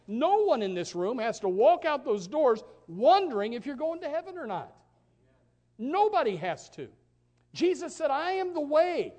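high, loud, driving preacher voice; full-force preaching. Acted. Preacher urging the congregation, loud and high. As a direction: hammer the truth home to the congregation.

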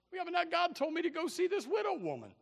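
high, disbelieving objection; incredulous reply. Acted. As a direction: push back, unconvinced. Acts Elijah answering.

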